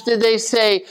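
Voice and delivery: ordinary talk, nothing marked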